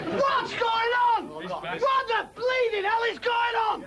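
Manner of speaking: furious comic rant; outraged rant. Acted. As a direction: bellow it in disbelief. Ranting comic character.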